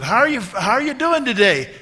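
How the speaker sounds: put-on high, loud hello to an elderly friend; loud retold greeting. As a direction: call out a cheery greeting.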